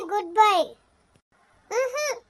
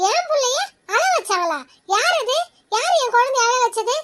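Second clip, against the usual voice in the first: raised, higher-pitched outrage; indignant mother; 3 semitones higher, 4 dB louder than usual. Acted. Mother asking who made her child cry, indignant. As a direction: demand an answer, loud and indignant.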